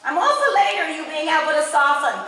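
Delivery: usual speaking voice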